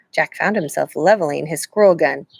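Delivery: usual speaking voice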